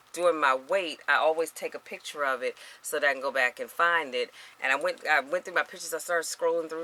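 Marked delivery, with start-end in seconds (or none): none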